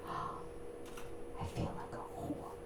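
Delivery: ashamed little whisper. Retold or imitated. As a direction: breathe it out in shame. Boyfriend ashamed, quiet.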